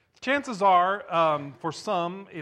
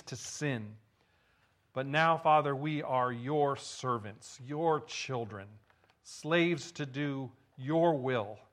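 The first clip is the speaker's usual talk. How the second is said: prayer; 3 semitones lower than usual. Real, not acted. Leads a prayer.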